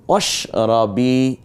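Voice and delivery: অশরাবি said very slowly, the final বি drawn out; অশরাবি with long bi. Shown as a demonstration. Quran teacher demonstrating the drawn-out vowel for students. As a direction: stretch বি in অশরাবি. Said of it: "এটা টানলাম কেন?".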